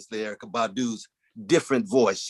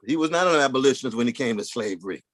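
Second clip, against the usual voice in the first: usual speaking voice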